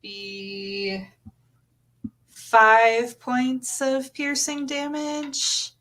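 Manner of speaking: normal talking voice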